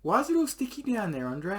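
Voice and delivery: put-on high-pitched puzzled girlfriend; mock girlfriend voice. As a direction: squeak it up like a confused girlfriend.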